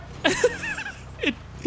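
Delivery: high, wheezing laughter; laughing hard. Real, not acted. Player cracking up at the crash, real laughter. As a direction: crack up helplessly.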